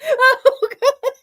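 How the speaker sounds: high shrieking through laughter; can't stop laughing, squealing; laughing fit. Real, not acted. Helpless laughing fit on stream.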